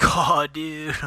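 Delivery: high, loud teasing Oh dude; jeering snowboarder girl; mocking laugher. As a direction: mimic a laughing onlooker.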